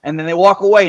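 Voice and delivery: ordinary talk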